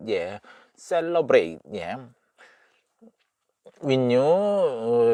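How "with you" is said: WITH YOU run together as "win-nyu"; linked with-you. Shown as a demonstration. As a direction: glide from "with" into "you" with no clear th. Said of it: "이렇게 발음하는 것 같아요".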